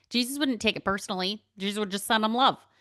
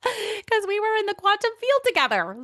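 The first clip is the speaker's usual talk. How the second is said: laughing through the line, much higher and louder; laughing at the coincidence; laughing delight; 12 semitones higher, 5 dB louder than usual. Real, not acted.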